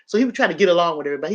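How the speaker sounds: ordinary delivery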